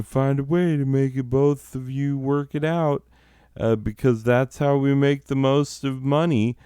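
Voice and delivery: lightly hammed-up corporate drone; mock corporate voice. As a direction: parody the smooth company line. Corporate voice quoted mockingly.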